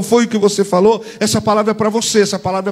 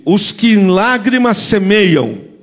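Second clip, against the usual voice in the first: slow, louder verse for the church to echo; louder call to repeat; 3 semitones higher, 6 dB louder, slower than usual. Shown as a demonstration. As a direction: lead the church in repeating it loudly. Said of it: "Repete comigo".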